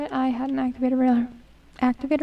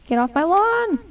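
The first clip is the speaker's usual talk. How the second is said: playful shout, louder and rising high; mock angry yell; 4 semitones higher, 5 dB louder than usual. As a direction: yell at intruders in mock outrage. Yells at zombie in the game.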